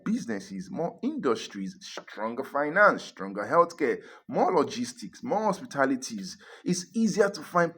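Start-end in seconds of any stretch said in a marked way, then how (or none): none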